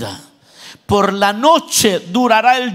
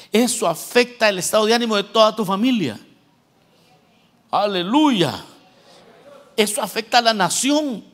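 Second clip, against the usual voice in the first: usual speaking voice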